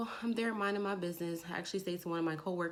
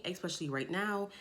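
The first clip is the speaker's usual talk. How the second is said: normal talking voice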